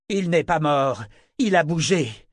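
grief-stricken refusal to accept it; frustrated. A narrator's reading. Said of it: "tant de frustration dans sa voix". (protestation frustrée, accablée de chagrin)

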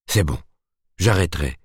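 ordinary delivery

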